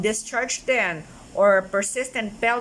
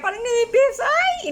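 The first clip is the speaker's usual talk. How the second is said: much higher, louder thinned-out voice; showing a voice gone thin after surgery; 4 dB louder than usual. Shown as a demonstration. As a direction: squeeze the voice up thin and high. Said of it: "Nag-iba yung boses ko".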